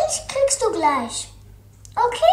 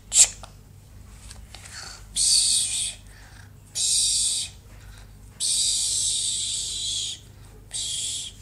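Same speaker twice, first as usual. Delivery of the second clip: unvoiced shush that gets softer and softer; fading shush; 5 dB quieter, much slower than usual. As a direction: hush them down to silence. Puppen schlafen, leiser werdend. (immer leiser werdendes Pst)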